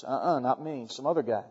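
low, soft, dodging denial; quiet denial. Retold or imitated. Peter denying he was with Jesus, low and evasive.

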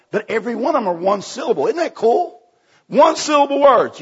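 thrilled to share a discovery; excited delight. Real, not acted. Preacher marvelling to congregation at one-syllable verse, excited.

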